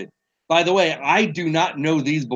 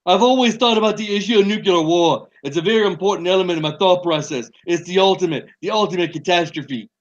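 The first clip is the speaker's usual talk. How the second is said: higher, bad Donald Trump impersonation; Trump impression; 4 semitones higher than usual. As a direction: read it in a hammed-up Trump voice. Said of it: "That's my Trump impersonation".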